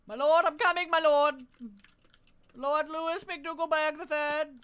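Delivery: loud, eager herald-like calls; servile knight. Pretend. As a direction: grovel loudly to your lord. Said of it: "You gotta talk to him like that when addressing him".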